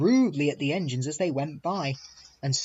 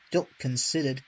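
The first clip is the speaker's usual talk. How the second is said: ordinary delivery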